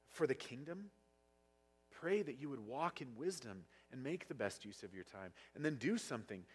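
ordinary delivery

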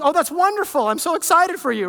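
high, loud fake excitement; overdone delight; mock gushing. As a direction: gush over it like a cheerleader. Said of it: "He goes".

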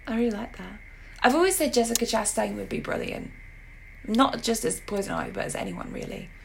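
ordinary delivery

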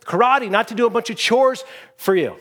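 high-pitched exasperated complaint; super frustrated pupil. Acted. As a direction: act out a fed-up student protesting. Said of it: "he's just super frustrated".

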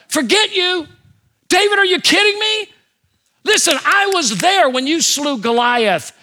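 high, loud disbelieving exclamation; as God, amazed anyone could think it; God answering David, astonished; incredulous. Acted. Voicing God's incredulous reply.

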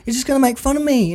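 high-pitched mock protest; Sean. Imitating Sean refusing Woody's invitation, high plaintive protest. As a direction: protest like a hurt kid, lightly acted. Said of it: "And Sean's like".

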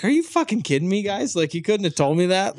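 exasperated disbelief. Retold or imitated. Retelling blood left on face.

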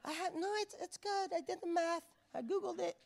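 high, soft voice with a giggle in it; silly put-on voice. Unwise virgin insisting she did the math, silly high voice. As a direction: play the clueless one in a tiny high voice. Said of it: "I don't know why I use that voice".

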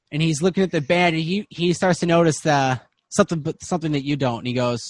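ordinary talk

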